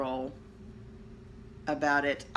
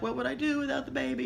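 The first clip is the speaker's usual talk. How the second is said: put-on higher, quicker child; kid voice; 9 semitones higher, faster than usual. Cartoon kid changing his mind, lightly voiced child. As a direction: lightly play a kid who loves the baby.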